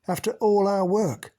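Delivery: mock the very idea; derisive. Mac scoffs at the doubting group, high and loud. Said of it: "He snorted derisively".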